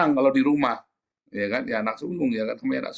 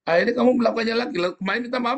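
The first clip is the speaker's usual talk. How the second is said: higher, fast scolding tone; mock scolding; 10 semitones higher, faster than usual. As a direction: scold the repeat offender, pitched high. Mocking repeated apology.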